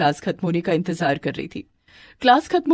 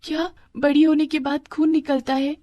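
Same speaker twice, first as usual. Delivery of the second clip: put-on high little-girl questioning; puzzled daughter; 8 semitones higher than usual. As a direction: ask in a high, astonished child's voice. Daughter questions mother in wonder.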